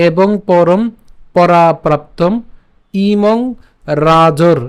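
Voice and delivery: slow, spaced phrase by phrase; teacher breaking the verse into chunks; careful, deliberate reading; slow demo reading. Shown as a demonstration. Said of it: "রেফের উচ্চারণটা বর্গীয় আজের সাথে".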